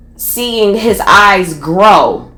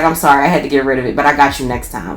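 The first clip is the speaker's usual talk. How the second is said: lower, fast, offhand excuse; dismissive apology; 6 semitones lower, much faster than usual. Retold or imitated. Dealer excusing bad weed to angry buyer, offhand low apology. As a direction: play the seller brushing it off, low and quick.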